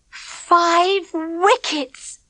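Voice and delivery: slow, high and gleeful; girl crowing over her bowling. A narrator's reading. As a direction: savour the triumph, stretch it out. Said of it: "Clipper said gleefully".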